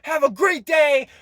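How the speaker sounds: high, loud shout out the car window; imitated yell. Hyped passenger yelling at people from the car window, loud high shout. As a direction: holler it at passersby like a hyped-up stranger. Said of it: "He's yelling".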